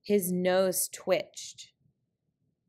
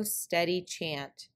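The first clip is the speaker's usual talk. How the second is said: ordinary delivery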